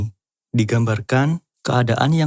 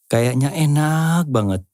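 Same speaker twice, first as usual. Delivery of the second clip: mock craving; 5 semitones higher, slower than usual. Voices craving for food.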